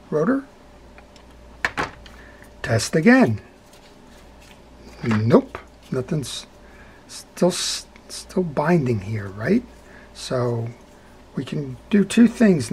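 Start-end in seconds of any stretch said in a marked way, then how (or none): none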